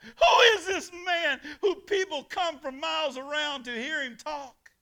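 high, loud awestruck shout; amazed crowd cry. Retold or imitated. Preacher voicing the crowd's amazement, loud shout.

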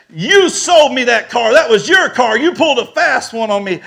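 loud, high, hammered-out accusation; angry accusation. Retold or imitated. Car buyer who feels cheated confronting the seller, angry. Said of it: "he calls me a liar".

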